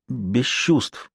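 soft, hushed and shaky reply; a frightened doctor, barely audible; trembling voice. A narrator's reading. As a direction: answer quietly, frightened. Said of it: "Лекарь дрожащим голосом ответил".

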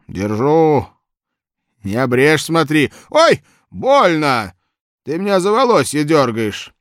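put-on loud, high old man complaining. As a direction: yelp like an old man in pain.

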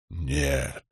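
low, soft godlike voice; rumbling Stormfather. A narrator's reading. Storm god denies it, low soft rumble. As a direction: let it roll low like far thunder. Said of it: "Голос загрохотал точно далёкий гром".

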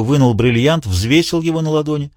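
ordinary delivery

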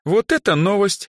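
high, astonished exclamation. A narrator's reading. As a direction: take in startling news aloud. Stunned by his ignorance.

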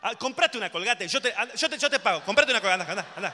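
loud, high, pushy urging; pushy toothpaste offer. Acted. Urging someone to buy toothpaste, loud and pushy. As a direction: shove the toothpaste offer at them.

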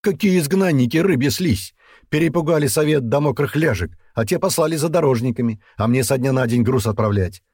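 high, loud tirade; furious berating. A narrator's reading. Ostakh berates Dian in a quarrel. (возмущённая тирада на повышенных тонах)

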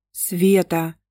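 usual speaking voice

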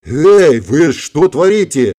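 loud, high hail into a drawn-out question; acted flabbergasted protest; stunned. A narrator's reading. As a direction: blurt it out, dumbfounded. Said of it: "ошалело спросил Эдди".

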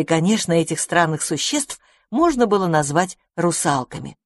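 ordinary delivery, nothing marked

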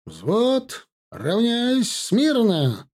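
high, slow drawn-out drill command; shouting drill sergeant. A narrator's reading. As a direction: bark the parade order across the yard. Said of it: "скомандовал Копорский". (протяжная строевая команда)

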